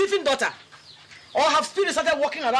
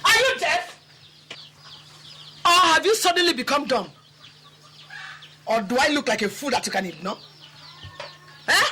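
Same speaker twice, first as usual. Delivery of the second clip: angry accusation. Acted. Angry neighbour confronts the wife over stolen vegetables. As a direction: lay into her like an angry neighbour.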